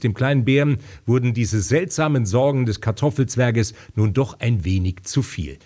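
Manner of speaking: plain reading voice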